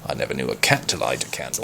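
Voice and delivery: soft, fast murmur to himself. Acted. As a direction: mutter it under your breath. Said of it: "murmured Peter".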